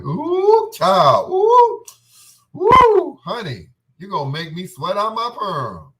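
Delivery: very high, very loud squealing camp voice. Retold or imitated. Teasing his girlfriend in bed, squealing camp voice. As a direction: shriek it high like a flamboyant diva. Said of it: "when I did it in the middle of lovemaking".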